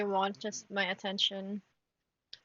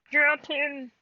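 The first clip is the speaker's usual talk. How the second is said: firm, much louder second command; louder repeat; 4 semitones higher, 10 dB louder than usual. Real, not acted. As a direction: raise the voice to make it stick. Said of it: "repeated louder".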